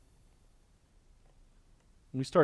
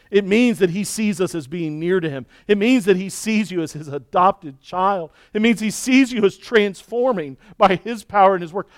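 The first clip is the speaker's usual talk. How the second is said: loud, high, driving preacher's climax; full-force preaching; 6 semitones higher, 9 dB louder than usual. Acted. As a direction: preach it with rising fire.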